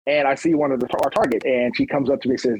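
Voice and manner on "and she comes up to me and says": ordinary delivery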